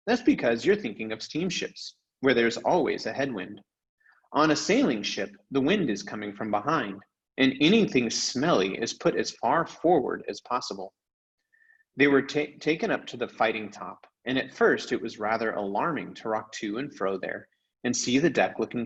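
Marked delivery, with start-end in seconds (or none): none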